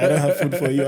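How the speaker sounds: laughing mock of mom saying it; mother's flat refusal. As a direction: mimic mom turning him away. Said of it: "That's how she said it".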